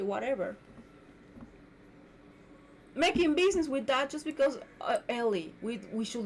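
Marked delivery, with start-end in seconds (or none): none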